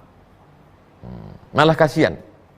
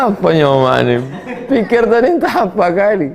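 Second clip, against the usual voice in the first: laughing through the words, getting louder; 4 semitones higher, 4 dB louder than usual. Real, not acted. Ustaz laughs at the mother's trivial request, laughing. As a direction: laugh in disbelief at how small the request was.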